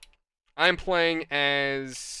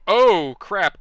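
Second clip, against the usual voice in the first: alarmed outburst; 2 semitones higher, 10 dB louder than usual. Retold or imitated. Retold alarm in the game.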